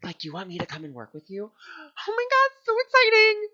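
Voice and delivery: overjoyed and breathless, rising to a squeal; gasping excitement. Retold or imitated. Offered a studio job, she gasps and squeals with joy.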